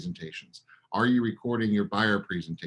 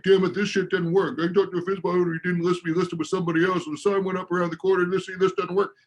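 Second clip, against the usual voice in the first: frustrated agent; 6 semitones higher than usual. Retold or imitated. Coach imitating an agent blaming the method, higher frustrated rant. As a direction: vent like a fed-up agent who lost the listing. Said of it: "You're just like".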